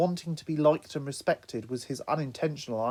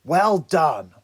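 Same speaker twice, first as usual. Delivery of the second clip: loud dry sarcasm; sarcastic; 3 semitones higher, 10 dB louder than usual. Acted. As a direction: sneer out the praise. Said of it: "began Raph sarcastically".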